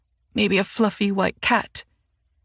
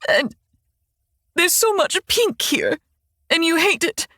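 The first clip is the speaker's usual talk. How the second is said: crying. Acted. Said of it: "tears still spilling, and she hiccups".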